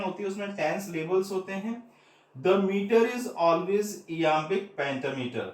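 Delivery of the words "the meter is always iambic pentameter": slow dictation. Shown as a demonstration. Dictating the definition to students.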